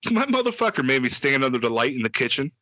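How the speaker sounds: ordinary talk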